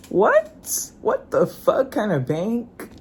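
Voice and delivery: sharp high squeak of disbelief; can't believe this bank; shocked. Real, not acted. Vlogger reacting to cursing bank staff, squeaky disbelief.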